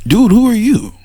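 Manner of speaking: high, mildly loud mock challenge; family confronting. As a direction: play the suspicious relative, sizing him up. [name] family confronting the filmmaker.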